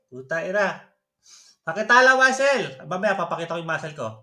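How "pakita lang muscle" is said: loud scornful echo; loud sarcastic jab; mocking repeat. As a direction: spit the phrase back, louder. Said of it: "Pakitaan na lang muscle?".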